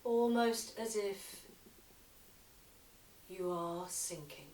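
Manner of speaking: slow yoga nidra guidance with long pauses; unhurried meditation voice, letting phrase endings sink; guided meditation. Acted. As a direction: lead them gently into relaxation, phrase by phrase. Leads a relaxation practice.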